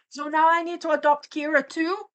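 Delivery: high, loud exasperated question; loud, high-pitched incredulity; indignant disbelief. Real, not acted. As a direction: exclaim it in playful outrage, pitch right up. Mock outrage reacting to fan story.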